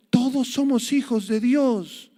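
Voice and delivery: high, slow, mocking quote; quoting the Pope. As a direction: imitate the Pope's phrase with a sneer. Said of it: "dijo ... el Papa Francisco". (cita burlona, aguda y lenta)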